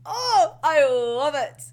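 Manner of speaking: slow, drawn-out delighted gush; slow emotional gush of delight. Real, not acted. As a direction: melt over how good it is. Gushing over the opening.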